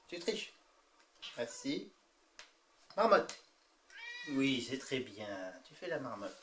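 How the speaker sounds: talking to a cat. Real, not acted. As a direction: coax the cat like a trainer. Training cats with treats.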